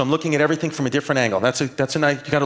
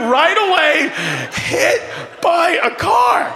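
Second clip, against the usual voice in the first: laughing through words; 11 semitones higher, slower than usual. Real, not acted. Comic cracking up telling how the dog got hit.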